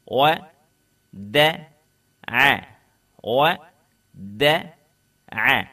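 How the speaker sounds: each letter said separately, not blended into a word; letter-by-letter drill. Shown as a demonstration. Teacher to students, reading the exercise letters separately. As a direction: drill the letters one by one, crisply. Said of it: "इसकी तमरीन जो है ... अलग-अलग जैसे आप वहाँ पर जो है, सुन रहे थे, वैसे ही पढ़िए".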